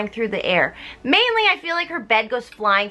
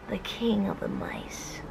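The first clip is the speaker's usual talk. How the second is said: hushed whisper; 4 semitones lower, 7 dB quieter, slower than usual. Real, not acted. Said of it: "hushed telling".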